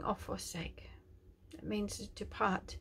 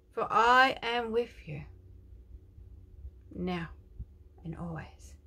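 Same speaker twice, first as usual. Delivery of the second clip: slow, louder, lifted voice of a loving Father; tender prophetic declaration; 4 semitones higher, 6 dB louder than usual. Acted. Preacher voices God comforting a hurting viewer, slow and tender. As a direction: speak as God comforting his child.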